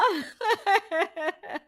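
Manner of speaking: high sneering laugh; a snide laugh; mocking laugh. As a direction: laugh at her plan.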